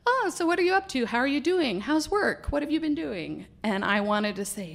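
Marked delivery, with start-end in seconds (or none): 0.1-3.5 imitating Mike. Mike chatting, asking about her.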